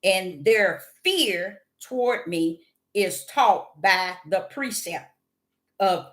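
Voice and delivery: slow, deliberate Bible reading; scripture reading. Acted. Reading Isaiah to her viewers, slow solemn scripture read. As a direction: read the verse slowly and solemnly.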